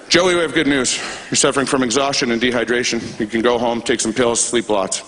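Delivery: low, matter-of-fact doctor; doctor's low, businesslike delivery; retold doctor. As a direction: reel off the diagnosis in a low, even voice. Retold doctor giving the diagnosis.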